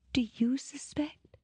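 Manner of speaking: breathy high whisper; tearful whisper. Acted. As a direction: whisper it through tears. Fake-tearful whisper before switching.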